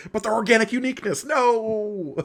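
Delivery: high, fast Borg voice. Pretend. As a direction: play a huffy Borg. Playing the Borg reply.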